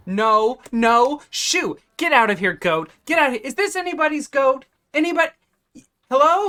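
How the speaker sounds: high, loud shooing-away shout; alarmed shouting. Acted. Shopkeeper chasing a goat from the stand, alarmed shouting. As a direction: call out loudly for the animal's owner.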